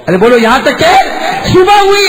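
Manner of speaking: high, loud call to the crowd; impassioned preacher at a high pitch; rousing full-force preaching. Acted.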